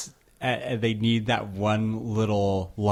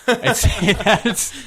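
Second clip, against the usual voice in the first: laughing through it, the last words high, loud and slow; laughing as she promises to tease him at work; 11 semitones higher, 7 dB louder, much slower than usual. Real, not acted. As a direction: giggle out a teasing promise.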